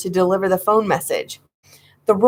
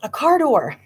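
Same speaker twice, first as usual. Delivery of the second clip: excited cry; 8 semitones higher than usual. Acted. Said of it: "I cried".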